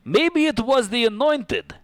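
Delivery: slow scornful scoff. Acted. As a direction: dismiss the idea with scorn. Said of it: "Damocles scoffed".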